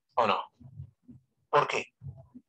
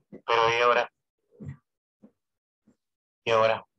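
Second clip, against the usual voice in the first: amplified voice; 2 semitones lower, faster than usual. Shown as a demonstration. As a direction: project it through the speaker. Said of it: "¿Quieres escuchar el volumen de mi voz?". (voz amplificada, más fuerte)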